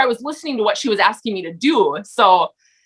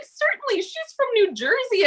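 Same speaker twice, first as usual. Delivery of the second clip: mock disbelief; 12 semitones higher, 4 dB quieter than usual. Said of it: "And I was like".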